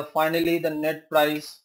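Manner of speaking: ordinary talk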